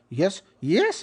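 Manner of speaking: YES pitched high, sliding up; varied intonation. Shown as a demonstration. Teacher trying out intonations on software, YES rising high. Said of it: "I tried a very different kinds of intonation".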